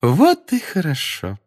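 high, slow, sugary singsong; honeyed voice. A narrator's reading. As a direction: sing it out sweetly. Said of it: "сладким голосом пропела".

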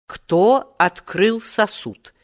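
lightly voiced businesslike inquiry. A narrator's reading. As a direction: ask it slowly, strictly to business. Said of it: "деловито осведомился тогда старичок".

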